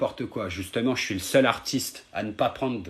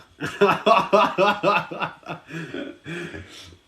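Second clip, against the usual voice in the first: long, high, loud burst of staccato laughter; mocking laugh; 7 semitones higher, 5 dB louder than usual. Real, not acted. Streamer laughing off a troublesome viewer, mocking laugh.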